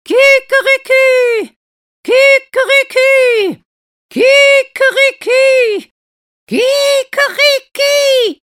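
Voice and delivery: very high, very loud drawn-out crows; rooster crowing. Acted. Rooster in a tree crows, very high and loud. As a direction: crow like a rooster on a branch. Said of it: "kräht".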